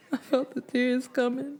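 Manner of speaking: high, choked-up narration; tearful. A narrator's reading. Widow feels the tears coming at hospital, tearful. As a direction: let tears creep into the narration.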